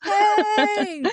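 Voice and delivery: high, drawn-out happy cheer; high celebratory squeal; delighted cheer. Real, not acted. Cheering the other speaker's wish.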